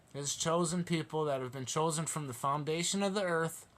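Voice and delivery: normal talking voice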